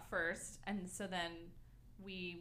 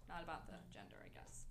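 whispering; 2 semitones lower, 13 dB quieter, faster than usual. Real, not acted. Trailing off mid-explanation.

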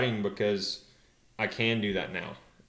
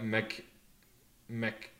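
Mc- cut off, softer, with a pause; stalled reading; 6 dB quieter than usual. Retold or imitated. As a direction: get stuck on "Mc-" and stop. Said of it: "they'll stop".

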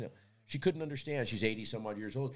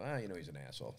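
muttered aside; 7 semitones lower, 8 dB quieter, faster than usual. Retold or imitated. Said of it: "And I go".

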